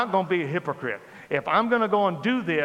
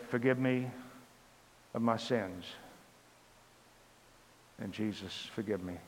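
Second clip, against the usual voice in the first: softer, low, reverent plea; hushed prayer; 6 semitones lower, 10 dB quieter than usual. Retold or imitated. Asking Jesus for forgiveness in a hushed voice. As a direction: pray it under your breath.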